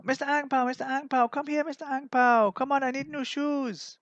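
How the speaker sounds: high, loud wheedling cop; police begging voice. Retold or imitated. Police calling Mister Ang Pao for bribe money, high wheedling voice. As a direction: coax him for money like a greedy officer. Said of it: "they used to call me".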